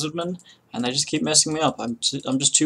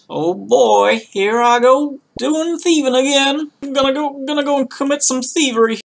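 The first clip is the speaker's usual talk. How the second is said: hammed-up southern twang, much higher; southern accent; 13 semitones higher, 7 dB louder than usual. Gamer slipping into a southern accent while going thieving. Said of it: "I don't know why I got so southern then".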